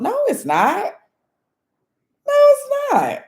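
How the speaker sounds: high-pitched, incredulous denial; exclaimed, disbelieving rebuttal; emphatic contradiction. Real, not acted. Contradicts what people claim.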